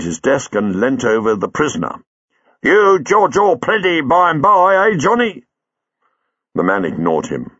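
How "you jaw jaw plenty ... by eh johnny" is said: higher, louder mock pidgin; mock pidgin taunt. A narrator's reading. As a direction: talk down to the prisoner, hammed-up. Sergeant mocks the prisoner.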